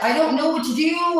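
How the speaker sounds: high, loud, hammed-up distress; mock desperate wail. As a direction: wail helplessly down the phone, exaggerated. Acting out her desperate reply.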